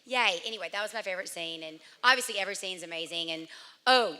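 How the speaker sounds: usual speaking voice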